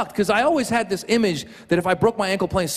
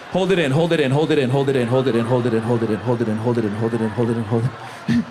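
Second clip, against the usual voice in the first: low, rapid mantra sinking lower; holding it back; 8 semitones lower, faster than usual. Acted. Comic mimicking a soccer fan holding back climax, low urgent chant. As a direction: chant it down low, as if restraining yourself.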